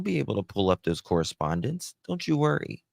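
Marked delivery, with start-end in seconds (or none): none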